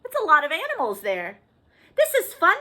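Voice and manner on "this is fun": Woodchuck. Acted. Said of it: "said Woodchuck".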